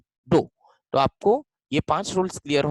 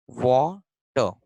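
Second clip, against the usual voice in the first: Water said very slowly, broken in two as if writing it; drawn out; 3 semitones lower, much slower than usual. Shown as a demonstration. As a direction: stretch water into two slow separate syllables, वा and टर. Teacher writes the word out. (Water को धीरे-धीरे, तोड़कर बोलें)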